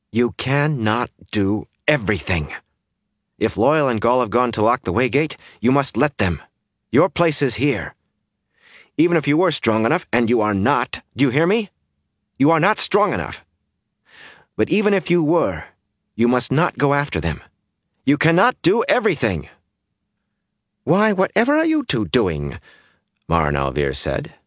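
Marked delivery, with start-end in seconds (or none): 0.1-19.5 high-pitched, emphatic scolding; urgent insistence. A narrator's reading. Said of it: "she said urgently". 20.8-22.6 very high, astonished exclamation; half scandalized, half amused. A narrator's reading. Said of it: "She sounded half scandalized and half amused".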